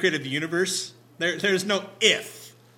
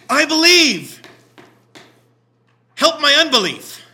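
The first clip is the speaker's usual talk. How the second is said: much louder and higher, pleading; desperate cry; 10 semitones higher, 14 dB louder than usual. Acted. Father pleading to Jesus for his son, desperate cry.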